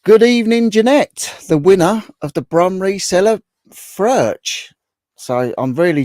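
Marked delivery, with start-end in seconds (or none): 0.0-4.7 high, loud announcer greeting; big loud welcome; excited greeting. Real, not acted. Greeting a viewer.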